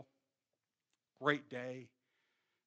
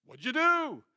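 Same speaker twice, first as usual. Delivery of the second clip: higher and louder; eager question; 10 semitones higher, 9 dB louder than usual. Retold or imitated. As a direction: ask it eagerly.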